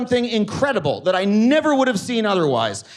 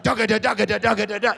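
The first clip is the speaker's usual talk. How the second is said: hums a Blue Man drum beat with his mouth; beatbox the drum line, lightly; mock drumming; faster than usual. Drummers on stage, mouth-drummed beat for the crowd. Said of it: "They're like".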